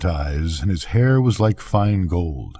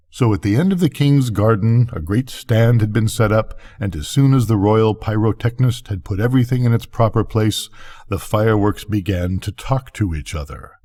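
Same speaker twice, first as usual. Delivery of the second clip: ordinary delivery, nothing marked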